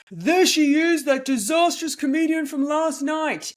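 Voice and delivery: high, loud heckler's announcement; booming yell. Retold or imitated. Comedian imitating her worst heckler at the elevator, booming yell. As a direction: boom it out for the whole ship to hear. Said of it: "he yells, that booming voice once again".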